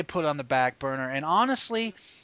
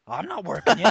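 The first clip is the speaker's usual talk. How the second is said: mock innocent denial, a bit louder; smile in voice; 3 semitones higher, 5 dB louder than usual. Worker called out on it, denying with a smile in the voice. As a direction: deny it with an audible grin. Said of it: "I can hear the smile in your voice".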